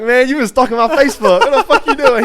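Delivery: loud, high-pitched incredulous accusation; mock outrage. Acted confrontation of friend over photo.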